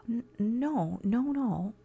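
flustered whispered stammer. Acted. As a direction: trip over the nos nervously. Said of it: "I stammered".

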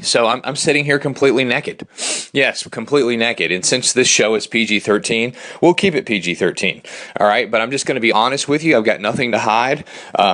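usual speaking voice

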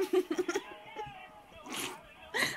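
laughing. Real, not acted. Told to stop laughing.

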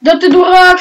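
loud insult at a teammate; angry shout. Real, not acted. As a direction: bark it out in anger.

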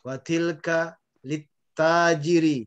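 slow "Watilka lit tajiri", long "ta" held; measured "lit tajiri", lam merged into the t; careful vowel lengths. Shown as a demonstration. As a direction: model "Watilka lit tajiri" for the class to echo. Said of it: "panjang pendeknya perhatikan".

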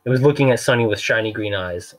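ordinary delivery, nothing marked

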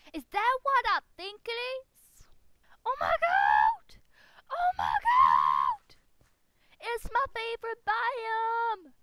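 screaming with joy, very high and loud; hammed-up fangirl scream; over-the-top excitement. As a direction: shriek it slowly, stretching the last words. Finds favorite biome, screams.

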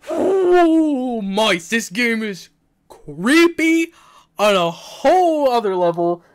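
loud high shaken exclamation; freaked out. Real, not acted. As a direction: cry out in shock. Reacting to scary game.

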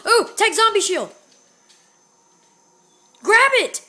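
high, loud urgent burst; excited calling out. Real, not acted. Urging a player to take the zombie shield, excited shout. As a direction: call it out eagerly, pitched high. Urgently calls out a spotted item.

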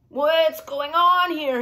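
put-on storybook old gnome, suspicious. A narrator's reading. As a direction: draw out each word loudly. Crooked Root hobbles up asking.

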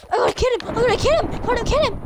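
high, loud, repeated chant; urgent yelling in the heat of a chase; excited battle cry. Real, not acted. Chasing an opponent in game.

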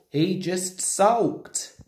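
ordinary delivery, nothing marked

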